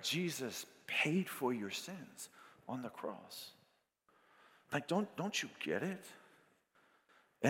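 preacher softly urging listeners about the cross; quiet appeal. Acted. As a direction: lean in and speak gently to the crowd. Tender quiet altar appeal.

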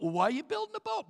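high, baffled neighbor question; mock high-pitched puzzled neighbor; clueless neighbor. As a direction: play the dim neighbor who never heard of it.

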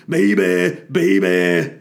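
put-on loud rapper croon of baby. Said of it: "shit [name] impression".

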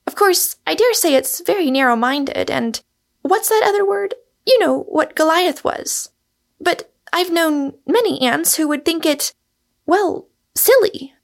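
put-on high-pitched innocent young woman character; high voice, slowing hesitantly before the last word; innocent Rosamond, hesitant.

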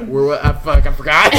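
loud, words broken by laughing; real helpless laughter. Real, not acted. Storyteller forgets where he was, real helpless laughter. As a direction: lose your place while cracking up. Loses his place from laughing.

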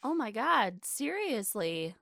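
ordinary talk

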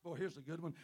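usual speaking voice

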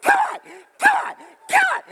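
high, loud, rushed calls to the dog; frantic shouted coaxing. Retold or imitated. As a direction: yell come on over and over, building. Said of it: "I'm like".

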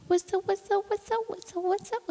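put-on high, repeated little-boy concern; worried toddler. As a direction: squeak it like an anxious toddler. Mother voicing worried toddler.

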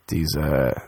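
usual speaking voice

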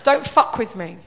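loud, laughing mock threat. Warning the troll in a loud, half-joking threat.

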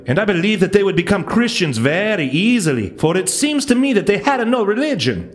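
hammy Italian explorer reading a letter; horrible Italian accent. Acted. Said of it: "that's a horrible Italian accent".

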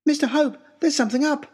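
put-on an alarmed boy waking his friend; Harry waking him. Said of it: "being shaken awake by Harry".

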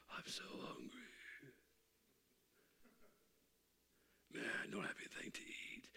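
whispered mock complaint of a hungry child; whiny daughter. Father voicing his daughter complaining at school, whispered. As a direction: breathe it out like a sulking kid.

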